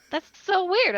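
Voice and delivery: amused, laughing surprise. Real, not acted. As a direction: laugh out the disbelief.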